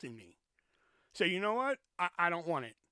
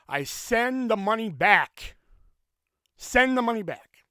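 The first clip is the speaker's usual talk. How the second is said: slow and much louder, each word hit; repeated emphatic line; 4 semitones higher, 9 dB louder, slower than usual. Shown as a demonstration. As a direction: hammer the point home. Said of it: "Listen to this carefully".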